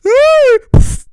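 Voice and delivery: high shrieking burst of laughter. Real, not acted. Reacting to a great pass, loud shrieking laugh.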